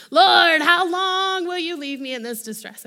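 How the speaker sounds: a loud, hammed-up lament cried out to the Lord; imitating a sulking psalmist, loud and high; sulky lament.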